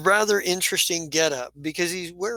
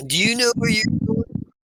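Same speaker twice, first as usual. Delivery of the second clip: questioning goblin; 7 semitones higher than usual. Acted. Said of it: "He's like".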